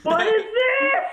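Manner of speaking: put-on very high, bewildered baby shriek; tripping baby. Comic voices a baby on an acid trip, high panicked bewilderment. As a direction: play a baby freaking out mid-trip. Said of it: "looks like they're mid-trip".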